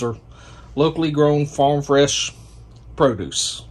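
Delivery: usual speaking voice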